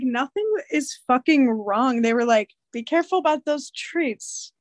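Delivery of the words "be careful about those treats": ordinary talk, nothing marked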